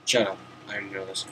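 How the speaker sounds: ordinary talk, nothing marked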